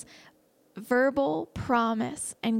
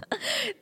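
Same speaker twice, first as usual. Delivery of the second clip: breathy high-pitched giggle; a delighted little laugh; 11 semitones higher than usual. Real, not acted. Giddy laugh after quote.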